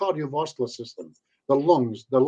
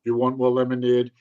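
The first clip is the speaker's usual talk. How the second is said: mock hypnotist voice; 4 semitones lower, 5 dB louder than usual. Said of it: "imagine if I talked like that to you in a pub".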